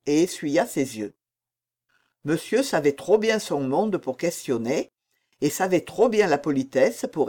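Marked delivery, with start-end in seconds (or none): none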